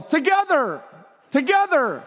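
high-pitched comic call-out, not full volume; mock yell. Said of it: "The friend begins to yell".

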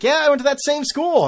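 high, loud mock agreement; sarcastic dad. Father doubting his son's excuse, sarcastic. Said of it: "not picking up on the sarcasm".

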